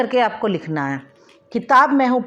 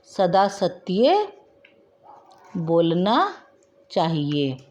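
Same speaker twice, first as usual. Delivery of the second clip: very slow words with pauses between them; slow dictation; 4 semitones lower, much slower than usual. Shown as a demonstration. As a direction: spell the sentence out slowly for the class. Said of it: "teacher dictating corrected sentence". (धीरे धीरे वाक्य लिखवाना)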